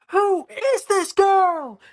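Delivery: high, slow, loud announcer shout; sports announcer. Acted. Announcer asks who this girl is, drawn-out high shout. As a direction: stretch each word out for the crowd. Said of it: "the announcer".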